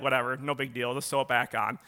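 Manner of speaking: imitating a nonchalant big man; unbothered big dude. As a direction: shrug it off like a tough guy. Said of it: "He's a big dude. He's like".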